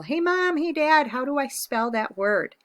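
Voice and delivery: high, loud call to parents; yelling. Pretend. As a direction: yell for help across the house. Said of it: "I didn't yell".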